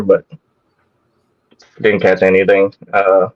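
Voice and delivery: ordinary talk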